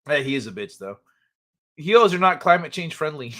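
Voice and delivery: ordinary delivery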